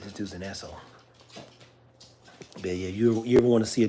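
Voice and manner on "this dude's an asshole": muttered aside. Retold or imitated. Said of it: "I said".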